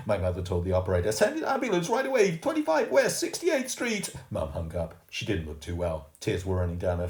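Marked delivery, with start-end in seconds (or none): 1.1-4.2 much higher, urgent demand; desperate and insistent; urgent plea. A narrator's reading. Mum giving the address to the operator, high and urgent.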